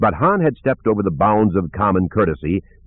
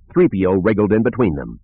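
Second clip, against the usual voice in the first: ordinary delivery, nothing marked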